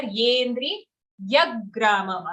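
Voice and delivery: "Yendri yagramam" with each opening y distinct; clear y sounds. Shown as a demonstration. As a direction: pronounce the y firmly in "Yendri" and "yagramam". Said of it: "the third ear also needs to be clear".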